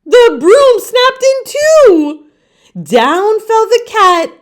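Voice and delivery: storyteller building to the break; dramatic snap. A narrator's reading. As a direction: crack the line out high and loud.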